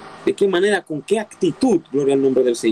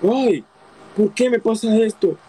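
mocking self-pity; 4 semitones higher than usual. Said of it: "No te quedes con el".

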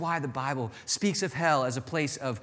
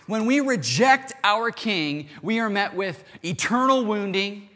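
higher and louder, slowing on the heavy words; full-force preaching; 7 semitones higher, 5 dB louder than usual. Acted. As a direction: preach it out, building to a climax.

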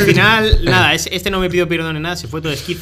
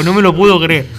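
louder retold disbelief, stressing puedo; 9 dB louder than usual. Retelling a bottle accusation at a concert, disbelief said louder. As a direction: say "No me lo puedo creer" louder, leaning on "puedo". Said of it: "Yo dije".

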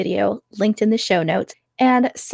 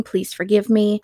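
normal talking voice

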